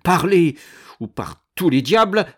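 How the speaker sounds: loud, high outburst; impatient threat, forcefully thrown out; threatening demand. A narrator's reading. Threatens the steward for news.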